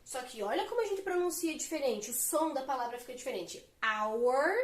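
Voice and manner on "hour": "Hour" said with a silent h, opening straight on the vowel "aw"; vowel-sound demo. Shown as a demonstration. Teacher showing students the silent h in hour. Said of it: "tô usando um som de vogal".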